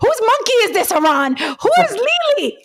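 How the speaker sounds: panic-stricken morning-after wail, hammed up for laughs; mock panic. Friend acting out waking up like The Hangover, mock panic.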